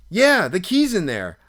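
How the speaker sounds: loud, high yeah, falling away; retold exasperated confirmation; exasperated echo. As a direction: throw it back as the obvious. Teller scornfully echoes friend's discovery.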